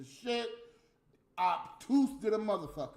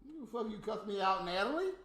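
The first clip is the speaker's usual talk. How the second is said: usual speaking voice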